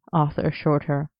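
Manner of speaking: ordinary delivery, nothing marked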